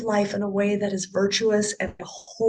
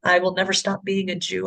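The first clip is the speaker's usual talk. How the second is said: normal talking voice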